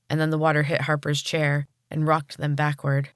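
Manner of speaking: plain reading voice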